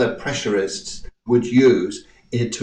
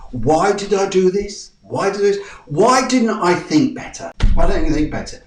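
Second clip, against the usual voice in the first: higher, exasperated self-questioning; mock your own past frustration; self-reproach; 6 semitones higher, 3 dB louder than usual. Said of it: "look back and say".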